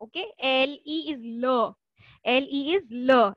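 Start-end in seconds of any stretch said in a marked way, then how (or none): 2.9-3.3 "ल" short, schwa vowel; clipped "ल", not "ले". Shown as a demonstration. Teacher modelling French "ल" for students. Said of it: "ले नहीं बोलना उसको".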